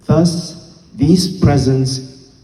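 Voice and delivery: slow, measured poetry reading with deliberate pauses; formal, emphatic verse reading to an audience; poem recital. Acted. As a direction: recite the poem slowly, giving each phrase its own weight.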